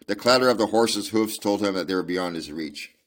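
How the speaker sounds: plain reading voice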